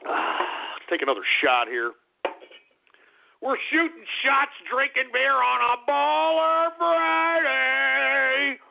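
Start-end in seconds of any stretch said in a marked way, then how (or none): 3.5-8.6 high, drawn-out party holler; boisterous whoop. Real, not acted. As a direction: crow it, stretching the last word long. Boisterous drinking boast.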